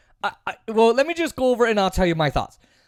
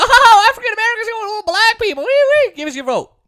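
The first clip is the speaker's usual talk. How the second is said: much higher, fast, fawning mock voice; mocking pandering Democrat; 12 semitones higher, 4 dB louder than usual. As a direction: parody fake pandering. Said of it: "Literally how they do it".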